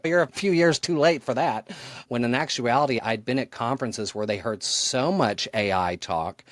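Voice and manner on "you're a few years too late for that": dismissive quote. Retold or imitated. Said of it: "I thought that was gonna be like".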